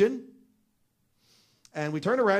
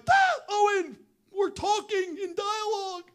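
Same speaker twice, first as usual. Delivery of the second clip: overjoyed. Real, not acted. Breakthrough, jumps out excitedly.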